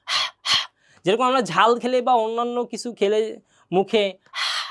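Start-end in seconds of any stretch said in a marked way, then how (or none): 0.1-0.6 হা breathed out voiceless from the throat; breathy ha. Shown as a demonstration. Teacher shows students the breathy হা sound. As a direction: exhale হা like after spicy food. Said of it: "একটা শব্দ হবে মুখের".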